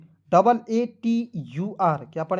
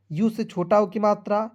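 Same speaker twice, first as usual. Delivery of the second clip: normal talking voice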